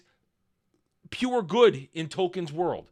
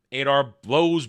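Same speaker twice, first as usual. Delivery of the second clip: normal talking voice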